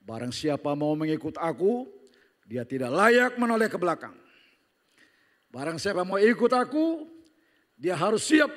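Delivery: preaching. Acted. Quoting Jesus in sermon.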